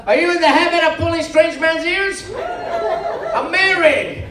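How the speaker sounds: loud, high-pitched comic indignation; mock outrage. Comic berating a volunteer who pulled his ears, mock-outraged.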